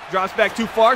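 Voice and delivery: usual speaking voice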